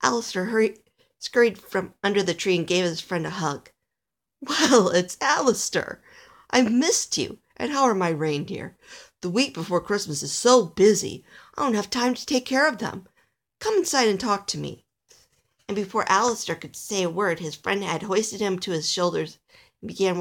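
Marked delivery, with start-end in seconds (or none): 4.4-14.8 higher, lively greeting; friendly Nicholas, delighted. Acted. Nicholas finding his friend Alistair, delighted. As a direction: greet a missed friend eagerly.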